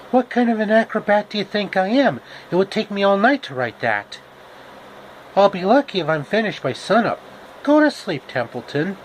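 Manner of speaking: Charlotte the spider voice. Acted. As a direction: shoo the rat off to bed.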